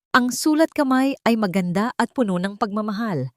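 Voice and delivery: usual speaking voice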